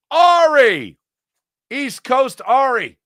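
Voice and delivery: loud, high, drawn-out name call; hyped shout-out. Real, not acted. Host greets chat regular from East Coast, hyped shout-out. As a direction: holler the name like a hype announcer.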